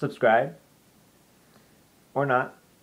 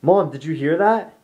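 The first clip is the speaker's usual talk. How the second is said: higher, louder urgent ask; alarmed question; 6 semitones higher, 6 dB louder than usual. Retold or imitated. Retelling running to mom in the kitchen, higher and louder alarm. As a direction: burst in on mom, rattled. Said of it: "I race to the kitchen and say".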